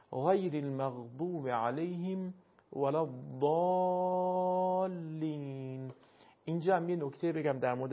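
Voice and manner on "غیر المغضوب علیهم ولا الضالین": slow recited verse, w in «ولا» and long aa in «الضالین»; Arabic recitation pronunciation. Shown as a demonstration. Teacher shows waw inside the verse, w in «ولا», drawn-out «الضالین».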